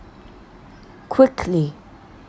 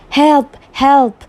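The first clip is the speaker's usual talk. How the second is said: a high, louder cry of Help! Help!; screaming for help; 6 semitones higher, 3 dB louder than usual. Acted. Girl screams at the wolf, higher and louder.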